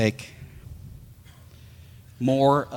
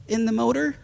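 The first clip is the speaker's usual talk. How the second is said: much higher, eager call to come listen; a friend's high, curious call; calling friend John; 13 semitones higher than usual. Retold or imitated. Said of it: "John says".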